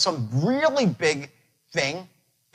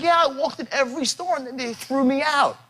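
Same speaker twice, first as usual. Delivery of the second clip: Shelley crying; 8 semitones higher than usual. Retold or imitated. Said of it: "She's like".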